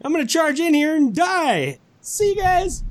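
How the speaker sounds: high, mock-cheerful farewell; sarcastically cheerful going to die; sarcastic doomed Theon. Theon thanking Bran sarcastically, high mock cheer. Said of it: "Oh, great".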